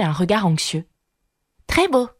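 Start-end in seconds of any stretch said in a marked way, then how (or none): 1.7-2.1 put-on high-pitched young Alice; girlish reply. A narrator's reading. Alice agrees with the rabbit: high girlish reply. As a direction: answer in a high girl's voice. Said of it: "dit Alice".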